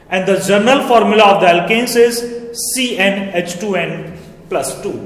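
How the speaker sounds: very slow, each word spaced for notes; slow dictation. Shown as a demonstration. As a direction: dictate the formula slowly, term by term. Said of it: "ये अब आप note कर लीजिएगा". (धीमा dictation, formula शब्द-शब्द)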